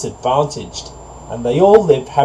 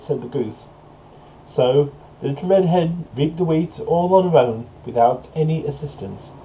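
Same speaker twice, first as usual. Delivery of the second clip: plain reading voice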